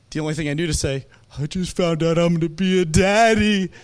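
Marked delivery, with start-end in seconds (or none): 1.4-3.7 hammed-up weepy announcement, louder; mock tearful blurt. New father telling an old man his news, mock tearful. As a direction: blurt it out as if crying with joy. Retold weeping confession to stranger.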